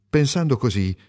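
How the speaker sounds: ordinary delivery, nothing marked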